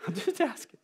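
laughing it off, breathy; laughing while he says it. Real, not acted. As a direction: chuckle through the line. Laughs at own joke.

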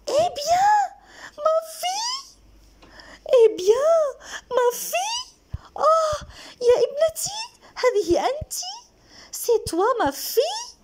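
very high, loud mother's cry. Acted. Mother cries out to her daughter as she spots her, high-pitched shout. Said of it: "Elle lui cria".

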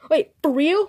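ordinary talk, nothing marked

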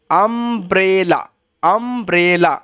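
Umbrella stretched very slowly, syllable by syllable; slow syllable drill. Shown as a demonstration. Teacher slowing down umbrella for students. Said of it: "এরকমভাবেই করবেন".

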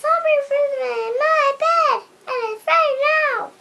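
tiny, very high squeal, hurried; Baby Bear. Acted. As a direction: squeak out a little bear's complaint at top pitch. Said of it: "Baby Bear said".

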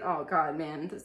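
ordinary delivery, nothing marked

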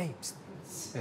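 whispered hiss to get attention; "pst, pst" under the breath; whispered psst. Retold or imitated. Trainer hissing at a seller, whispered psst. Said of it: "le tiro una cáscara de".

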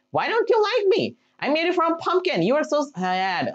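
hurt disbelief. Real, not acted. Gamer attacked by the golem he built, hurt protest. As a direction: whine like a betrayed friend.

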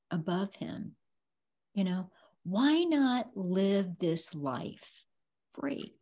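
ordinary talk, nothing marked

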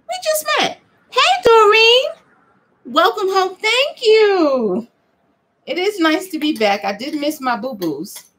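high, bubbly sing-song greeting; playful greeting. Retold or imitated. Greeting sister Doreen back home, high and bubbly. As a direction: ham up a cheerful welcome home.

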